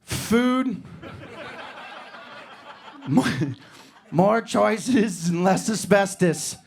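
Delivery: put-on lightly lampoon a scornful old-timer; Depression-era grandpa. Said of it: "was like".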